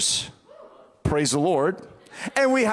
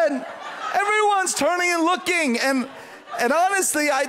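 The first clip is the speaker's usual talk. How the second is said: usual speaking voice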